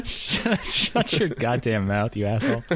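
laughing mock retort. Teased co-host answering back, laughing mock outrage. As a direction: pretend to tell a friend off while cracking up.

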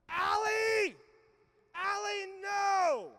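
put-on high, loud child yelling at his sister; imitating Judah yelling at his sister to keep off; child shouting. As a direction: shout like a boy defending his toy. Judah grabbing toy from sister.

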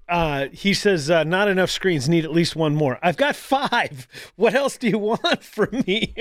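normal talking voice

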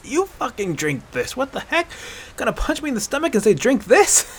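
high, strained, incredulous protest; wheezing. Acted. Winded hunter protesting after the punch, wheezing. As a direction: wheeze out the outrage. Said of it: "His wheezing voice".

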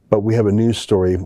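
usual speaking voice

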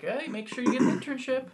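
high, bright nagging advisor voice; preachy advisor. Retold or imitated. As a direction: urge like a pushy counselor. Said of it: "being like".

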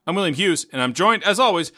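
ordinary talk, nothing marked

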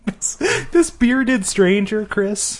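high, drawn-out melodrama; comic high-pitched alarm; mock dread. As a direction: dwell on the menace, hammed up. Mock rant about wizards.

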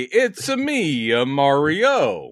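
slow, lightly voiced Italian plumber; Mario voice. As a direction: stretch it out like Mario. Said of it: "Is that good?".

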